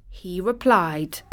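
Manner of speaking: ordinary delivery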